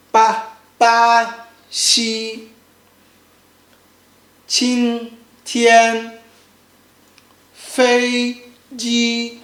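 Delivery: BA XI, QING TIAN, FEI JI on one level pitch; each syllable of ba xi, qing tian, fei ji held flat; first tone. Shown as a demonstration. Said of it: "The key point is this sound".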